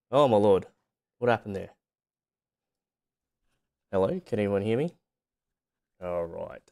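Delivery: ordinary delivery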